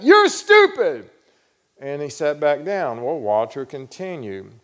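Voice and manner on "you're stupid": high, loud, drawn-out heckle; heckler's shout. Retold or imitated. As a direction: shout it out from the back of the room. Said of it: "he shouted out".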